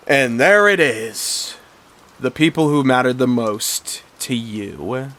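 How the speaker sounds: villain brother sneering back, lightly voiced and slow; a hint of a cold villain. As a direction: savour the words, lightly voiced. Villain brother answers bitterly.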